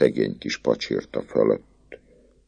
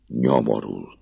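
plain reading voice

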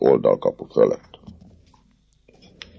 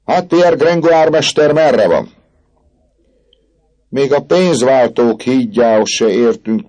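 character voice; 12 semitones higher, 6 dB louder than usual. Acted. Vagabonds ask about Gringoire.

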